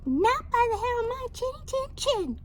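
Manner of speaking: very high, fast squeaky pig; high piggy refusal; little pig voice. Acted. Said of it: "cried the second little pig".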